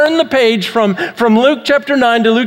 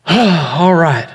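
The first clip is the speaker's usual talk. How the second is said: heavy sigh, then a low "all right"; resigned sigh; 4 semitones lower than usual. Retold or imitated. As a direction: give in reluctantly with a sigh. Reluctantly gives in, sighing.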